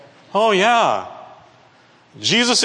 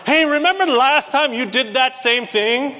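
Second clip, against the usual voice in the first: higher-pitched, pouncing accusation; mock grudge-holder calling someone out; accusing reminder; 7 semitones higher than usual. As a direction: dredge up an old offence. Acted accuser dredging up old offence.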